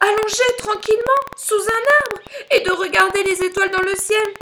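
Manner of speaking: high, impassioned outpouring; roared tender words. Acted. Lion roars what he wishes he could write, impassioned. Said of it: "se mit à rugir".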